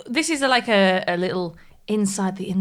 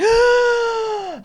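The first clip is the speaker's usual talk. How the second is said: loud, high mock gasp; exaggerated long, high-pitched intake of breath; gasp for air; 4 dB louder than usual. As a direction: come up for air with a big gasp. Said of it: "had to come up for air".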